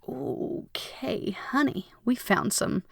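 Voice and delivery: soft, whispered reassurance; tender self-talk. Retold or imitated. Speaking gently to herself about old stories, soft and tender. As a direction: soothe yourself like a loved one.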